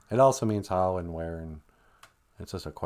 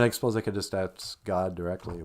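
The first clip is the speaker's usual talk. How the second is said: ordinary talk, nothing marked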